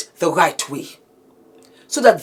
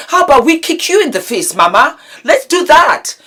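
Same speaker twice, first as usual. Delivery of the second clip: higher, defiant taunting threat; angry threat; 7 semitones higher, 4 dB louder than usual. Real, not acted. Vlogger taunting the abusive mother, angry. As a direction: challenge her, rising and heated.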